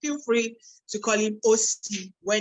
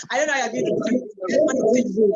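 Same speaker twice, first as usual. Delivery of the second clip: said through a real laugh, a bit louder; 3 semitones higher, 6 dB louder than usual. Real, not acted. Host teasing OP about his three minutes, laughing. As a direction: laugh the words out, amused at the tight deadline.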